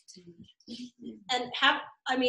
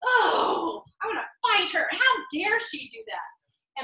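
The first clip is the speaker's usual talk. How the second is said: higher, put-on outrage with a sob-like edge; mock indignant outrage; 7 semitones higher, slower than usual. Coach voicing a reader who wants to fight, mock outrage. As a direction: play the offended reader itching to fight. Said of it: "It's like".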